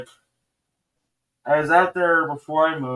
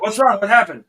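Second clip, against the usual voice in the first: alarmed question; 8 semitones higher, 7 dB louder than usual. Retold or imitated. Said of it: "I'm like".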